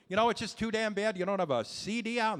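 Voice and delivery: ordinary talk, nothing marked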